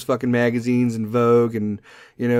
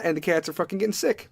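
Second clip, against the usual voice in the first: ordinary talk, nothing marked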